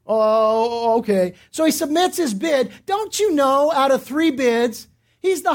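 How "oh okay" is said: low, drawn-out grudging reply; sullen, dragged-out consent, pitched down; reluctant agreement. Retold or imitated. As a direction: imitate someone giving in unwillingly. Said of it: "the guy reluctantly agrees".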